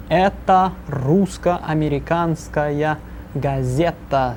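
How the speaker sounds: это русско-американская газета said slowly, every syllable clear; slow model phrase. Shown as a demonstration. Teacher modelling the sentence for students, slow and clear. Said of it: "Say it with me once again".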